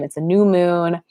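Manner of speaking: usual speaking voice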